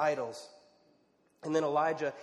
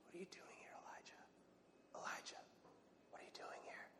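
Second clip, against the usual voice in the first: soft whispered divine question; whispered as God; 2 semitones higher, 24 dB quieter, faster than usual. A narrator's reading. Preacher voicing God in a low whisper. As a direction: breathe the words like a still small voice. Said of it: "a sound of a low whisper".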